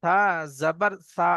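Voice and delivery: slow, clear call-out of each letter; recitation drill. Acted. Teacher leads students in a slow letter drill. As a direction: chant the letters for the class to repeat.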